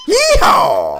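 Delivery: loud, high cowboy holler; big playful rodeo shout; cowboy yeehaw. Pretend. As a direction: holler it out like a cattle driver. Cowboy cheer in reply to rating.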